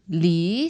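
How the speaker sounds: li rising like a question; second tone. Shown as a demonstration. Teacher isolates the changed word for learners, second tone. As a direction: lift li upward in second tone. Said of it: "second tone".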